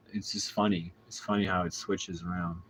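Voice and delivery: ordinary delivery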